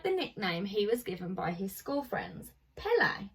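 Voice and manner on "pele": ordinary delivery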